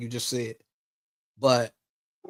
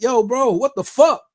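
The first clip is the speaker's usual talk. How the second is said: retold angry outburst, louder and higher; pissed off; 8 semitones higher, 5 dB louder than usual. Cousin confronted about his online friends, retold annoyed outburst. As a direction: raise your voice, replaying your irritation. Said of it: "I'm getting pissed off".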